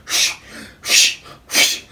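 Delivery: voiceless huffing breaths, like someone straining through reps; mock workout panting. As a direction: puff out short exercise breaths. Imitates roommate's workout breathing.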